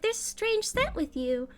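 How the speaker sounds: high nervous kitty; high wary cat. Acted. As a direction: sniff out something odd. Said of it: "She's like".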